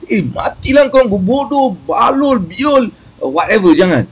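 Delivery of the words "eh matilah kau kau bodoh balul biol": mock angry cursing. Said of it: "kau jangan doa".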